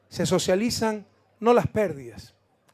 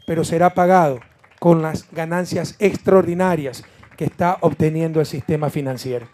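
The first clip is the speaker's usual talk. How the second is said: ordinary delivery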